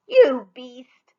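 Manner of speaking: loud jeering taunt; mocking taunt. Sisters mocking sooty Rella, loud jeering taunt. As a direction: sneer it out loud. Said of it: "they laughed and held their noses".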